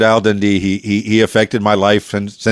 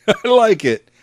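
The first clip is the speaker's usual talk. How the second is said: laughing; 10 semitones higher, slower than usual. Real, not acted. Amused at Aussie self-mockery.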